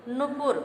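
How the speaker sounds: नुपुर with short first u, "nupur"; mispronunciation. Shown as a demonstration. Teacher presenting the wrong word, short first vowel. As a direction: say नुपुर with two short u's. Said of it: "हमारा अशुद्ध है".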